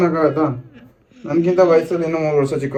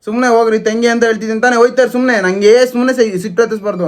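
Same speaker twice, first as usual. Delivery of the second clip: angry; 5 semitones higher, faster than usual. Acted. Angrily sends her away.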